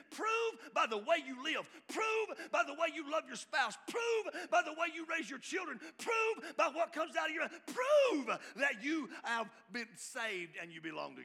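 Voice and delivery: loud, high shouted commands; fiery preaching. Acted. John the Baptist demanding proof from Pharisees, fiery shout. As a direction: hammer each prove at the crowd.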